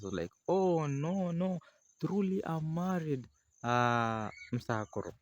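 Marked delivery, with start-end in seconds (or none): 0.5-3.3 high insisting voice. Retold or imitated. Lady protesting in story.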